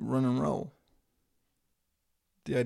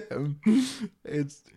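ordinary talk, nothing marked

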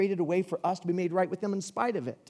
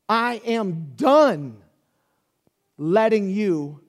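loud, slow, emphatic preaching; preaching at full force; 3 semitones higher, 6 dB louder, slower than usual. Acted. Preacher building to God's warning, loud slow preaching. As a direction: drive each word home slowly.

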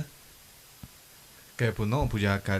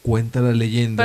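spooky storyteller; 5 dB louder than usual. Acted. Spooky legend ending.

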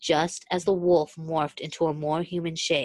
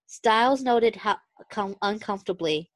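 plain reading voice

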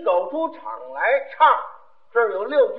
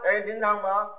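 ordinary talk

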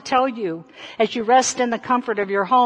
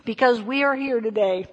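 ordinary delivery

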